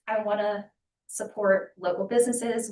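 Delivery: normal talking voice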